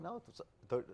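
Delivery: normal talking voice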